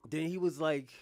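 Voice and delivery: ordinary delivery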